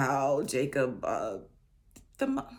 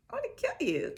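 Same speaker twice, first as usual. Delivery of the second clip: squeaky high mock threat from a furious brother; a hammed-up jealous twin threatening; mock angry threat; much faster than usual. Mad brother Esau threatening Jacob, high mock threat. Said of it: "he's like".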